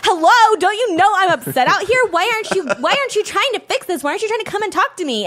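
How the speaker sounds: retold angry outburst. Wife reenacting yelling at husband, high and exasperated. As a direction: replay the indignant rant, pitched high.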